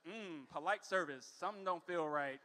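suspicious mutter. Retold or imitated. His suspicious inner voice.

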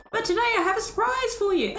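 high, brisk showman's announcement; grand robot benefactor. Acted. Robot promising villagers a surprise, showy. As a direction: tease the crowd like a game-show host.

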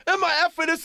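high-pitched yelled cursing, imitated; mock background screaming. Manager heard behind the phone call, mock screaming. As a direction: yell it like a rant behind the caller. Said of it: "Russell in the background screaming".

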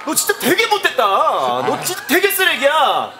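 high-pitched, indignant condemnation; disgusted scolding. Acted. Comedian condemning partner's sleazy excuse, disgusted scolding. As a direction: scold in appalled disbelief, pitch swooping up.

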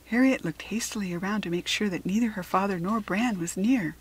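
ordinary delivery, nothing marked

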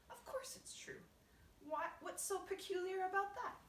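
indignant. Acted. Grasshopper contradicted by disbelieving James.

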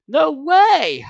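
high, loud excited outburst; excited cry. Acted. Gabe shouts excitedly at Uncle Ben's doubt. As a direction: burst out with thrilled disbelief. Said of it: "I cried excitedly".

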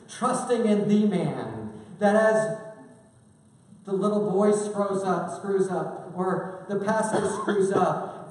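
high, loud, driving sermon voice; full-force preaching. Acted. Preacher rising to a loud climax for the congregation. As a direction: hammer the point home to the congregation.

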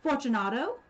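loud, rising call into the dark. Acted. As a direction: call out, testing for an answer. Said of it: "I called aloud".

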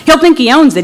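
ordinary delivery

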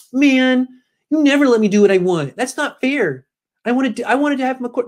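put-on high, whining spoiled-kid voice; whiny Kyle. Mocking coach Kyle, complaining to his bosses in a high whine. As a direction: whine like a kid who didn't get his way. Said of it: "does Kyle whine like that".

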